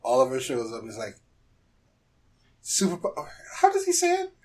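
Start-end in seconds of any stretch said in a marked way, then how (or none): none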